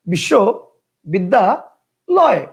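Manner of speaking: বিশ্ববিদ্যালয় slowly split as বিশ্ব, বিদ্যা, লয়; three separate chunks. Shown as a demonstration. Teacher shows students another pronunciation, বিশ্ববিদ্যালয় in three separated chunks. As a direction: pause twice inside বিশ্ববিদ্যালয়, making three slow pieces. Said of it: "কেউ উচ্চারণ করতো".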